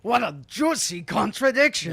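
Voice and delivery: high, loud mock-Scottish; hammed-up Scottish exclamation; Scottish accent. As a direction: put on a big Scottish accent. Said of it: "do a Scottish accent".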